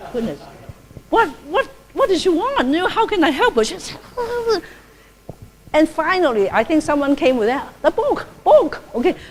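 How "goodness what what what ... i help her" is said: retold bewildered exclamation, pitched higher and laughing; flustered puzzlement. Nun at the book fair, baffled by a visitor who speaks only Vietnamese, laughing flustered surprise. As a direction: play your own flustered confusion at the visitor. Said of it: "and I said".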